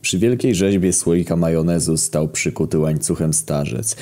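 ordinary delivery

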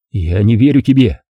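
ordinary delivery, nothing marked

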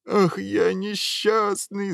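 slow, high-pitched drawn-out wail. A narrator's reading. Prince bemoaning his misery to the ogre, wailing. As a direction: wail in theatrical self-pity. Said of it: "завопил принц".

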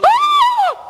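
high, loud comic yelp; shocked cry. Acted. Owner reacts to the phone bill, shocked cry.

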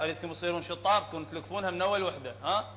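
ordinary talk

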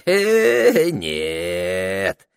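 crafty, drawn-out refusal; sly drawl. A narrator's reading. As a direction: draw it out slyly. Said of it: "с хитрым видом протянул Этельред".

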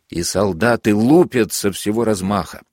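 usual speaking voice

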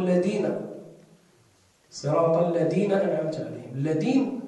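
"الذين" recited with a pure d, "alladina"; dhal as dal. Shown as a demonstration. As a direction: make the dh of الذين a plain d. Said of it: "هناك من ينطقها دالا خالصا".

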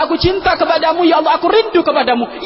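high, loud proclaimed love; loud prayer. Shown as a demonstration. Believer loudly proclaiming love to God, loud cry. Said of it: "loud version of prayer".

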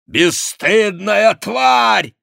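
high, loud, slow furious cry; shout. A narrator's reading. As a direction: hurl the insult out, drawn-out and high. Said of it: "крикнул Монсоро". (яростный протяжный крик)

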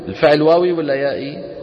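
ordinary delivery

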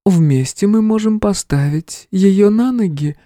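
high, slow divine voice; goddess. A narrator's reading. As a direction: speak as a calm deity. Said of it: "продолжила богиня".

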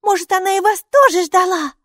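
high, loud question; high-pitched small creature wondering; hopeful little character. A narrator's reading. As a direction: pipe up eagerly. Maika asks hopefully.

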